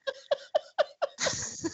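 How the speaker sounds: bursting into a breathless laugh. Real, not acted. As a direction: laugh out hard at the joke. Laughing at the story.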